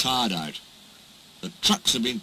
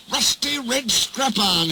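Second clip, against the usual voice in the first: hissing; 9 semitones higher than usual. Acted. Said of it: "hissed James".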